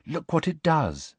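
a hint of dreamy pride; dreamy girl. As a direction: show off airily. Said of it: "a vague and dreamy voice".

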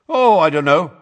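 loud, fast, commanding judge; stern judge overruling the minister; booming. A narrator's reading. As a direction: fill the room with your voice. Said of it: "in her booming voice".

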